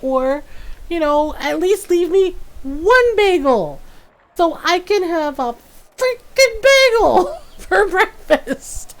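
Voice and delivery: high, slow whiny complaint; exasperated mom. Retold or imitated. Mom whining to her daughter about her eaten bagels. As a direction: whine it like a fed-up mom robbed of breakfast.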